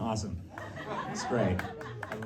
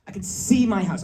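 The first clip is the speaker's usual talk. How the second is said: acted desperation, higher pitch; desperate; 6 semitones higher, 4 dB louder than usual. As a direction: plead it as if desperate to get home. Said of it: "I'm, like, desperate".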